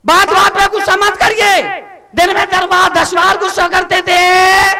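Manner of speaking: high, forceful sermon shout; preaching at full force. Real, not acted. Monk urging families to change themselves, full-force preaching.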